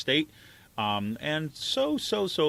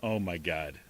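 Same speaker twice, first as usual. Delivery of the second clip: ordinary talk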